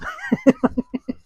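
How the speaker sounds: high, amused burst of laughter; real laugh. Real, not acted. Host mocking people who lie on insurance forms, laughing. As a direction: laugh at your own joke.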